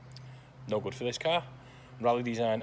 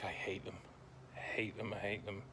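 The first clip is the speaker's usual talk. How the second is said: quiet; 13 dB quieter than usual. Real, not acted. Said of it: "I'm being really sarcastic".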